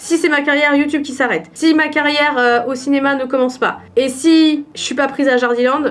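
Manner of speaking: usual speaking voice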